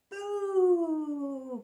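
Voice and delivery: loud, high Boo shout; Boo cry. Acted. Spike scaring Splat with a loud high Boo. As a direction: jump out and scare them. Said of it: "cried Plank".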